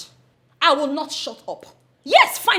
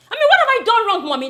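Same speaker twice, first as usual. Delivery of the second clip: indignant raised voice; 8 semitones higher, 6 dB louder, faster than usual. Acted. Daughter's quarrel escalates with uncle.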